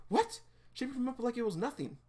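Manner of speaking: high-pitched disbelief; amazed. Acted. Girl watching Remy lift him easily, amazed. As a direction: squeal the question, then marvel.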